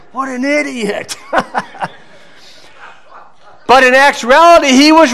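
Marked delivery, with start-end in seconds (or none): 0.1-1.1 high, soft mock murmur; mimicked scornful onlookers. People in the back judging the defendant, scornful. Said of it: "people were saying". 1.2-1.9 high, soft chuckle; amused laugh. Real, not acted. Preacher amused by the onlookers' remark, chuckling. Amused at his own story.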